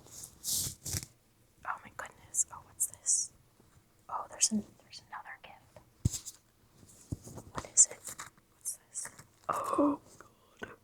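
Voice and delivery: hushed, barely voiced amazement; awed whisper. Real, not acted. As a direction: whisper it while peeking at a surprise. Awed peek into the next bag.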